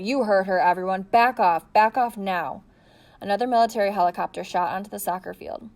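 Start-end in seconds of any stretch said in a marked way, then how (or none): 0.0-2.6 louder, firm command; commanding order. A narrator's reading. Marine officer ordering journalists back, commanding. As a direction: push the crowd back with your voice.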